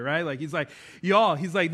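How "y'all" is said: loud, lightly Southern greeting; Southern accent. Acted. Preacher voicing Paul to the church, loud, lightly Southern accent. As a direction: call it out with a hint of Southern. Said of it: "he's a little Southern right there".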